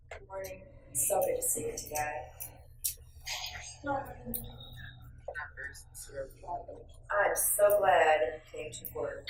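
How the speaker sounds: flat, soft, grudging greeting; unwelcoming and cool; cold greeting. Shown as a demonstration. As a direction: say it like you resent them. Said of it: "with a different look on your face".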